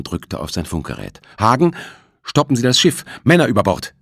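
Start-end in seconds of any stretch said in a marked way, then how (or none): 1.4-3.9 higher, louder urgent orders; urgent radio call. A narrator's reading. Thompson calling the captain on the radio, urgent shout.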